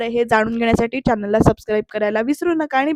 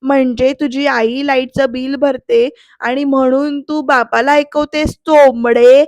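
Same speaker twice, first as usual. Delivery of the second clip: slow, loud scolding that builds; drawn-out accusation, rising in volume; angry taunt; 7 dB louder, slower than usual. Retold or imitated. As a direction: imitate a father berating his daughter. Acted father sneering, then raging.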